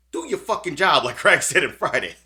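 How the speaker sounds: fed-up outburst, let it rip; exasperated shout. Real, not acted. Streamer yelling at police to do their job, fed-up shout.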